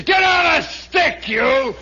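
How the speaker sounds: drawn-out high military yell; slow, loud drill-sergeant holler; sergeant's shouted order. Acted. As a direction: bawl out a soldier on the floor. Duty sergeant barking an order, acted.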